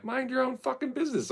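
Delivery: high mock tough-guy voice; mock thief yelling. Thief telling him to mind his business. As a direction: snap back like a street thief. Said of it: "And they were like".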